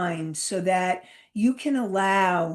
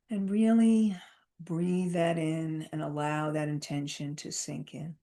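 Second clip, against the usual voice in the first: calm grounding exercise; 2 semitones lower, 3 dB quieter than usual. Acted. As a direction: ease them down into calm. Leads a breathing grounding exercise.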